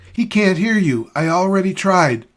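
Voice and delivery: high, loud yell to be heard; screaming. A narrator's reading. Annie yells to her fellow captive over the calliope, screaming. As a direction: yell at the top of your lungs, high-pitched. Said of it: "screaming at the top of her lungs".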